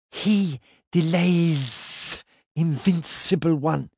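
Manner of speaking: slow, high, sibilant hiss; hissing serpent dragon. Acted. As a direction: draw out every s like a serpent. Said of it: "in her sibilant voice".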